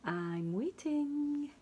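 slow high sing-song; sing-song waiting. Acted. Sam waits.

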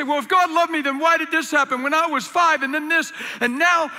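high, loud mock complaint of a hurt person; bitter complaint. Hurt person arguing God's love, loud complaint. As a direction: act out someone angry at God. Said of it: "And people say".

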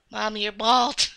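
ordinary talk, nothing marked

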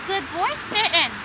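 high, sing-song dog praise. Real, not acted. Owner praising her dog for sitting, high and sing-song. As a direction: gush over the sitting dog. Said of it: "give him lots of praise".

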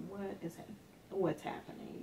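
hushed and stunned, a near whisper; stunned whisper to herself. Retold or imitated. Teacher thinking to herself in shock, hushed whisper. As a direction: breathe it out in disbelief. Stunned inner thought in class.

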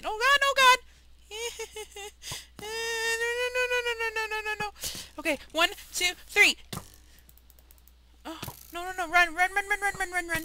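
high frantic shouts while fleeing; being chased. Real, not acted. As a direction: yell run in panic.

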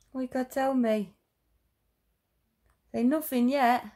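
put-on high baby talk. Mother chatting to her recovering daughter, high sing-song baby talk. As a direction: chatter brightly up high to the little one.

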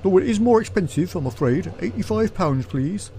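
train guard. Acted. Guard charging passengers without tickets, officious guard voice.

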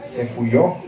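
normal talking voice

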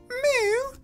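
tiny, very high squeak; Mew cry. Acted. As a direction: squeak like a little cartoon creature. Voicing Mew's cry.

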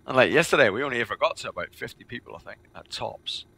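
normal talking voice

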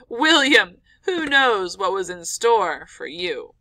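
loud, slow teasing spooky warning; mock ominous father. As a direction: play up the danger to tease the boy.

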